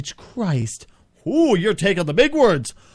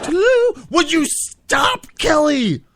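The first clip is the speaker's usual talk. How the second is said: squealed catchphrase; 8 semitones higher, 3 dB louder, slower than usual. Acted. Catchphrase then exasperated plea.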